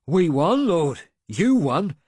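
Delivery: loud, high eager praise; a proud old soldier's eager claim; proud insistence. A narrator's reading. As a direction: insist on the lord's victory. Veteran recalling battle proudly.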